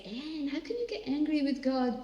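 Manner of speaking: questioning listener. Pretend. Church member asks Anne, lightly incredulous. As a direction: ask it like a puzzled churchgoer. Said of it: "And you say".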